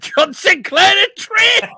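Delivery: high, very loud outburst; loud shriek breaking into laughter; shocked shout. Real, not acted. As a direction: yell it out in disbelief, high-pitched. Shocked shout at a food picture.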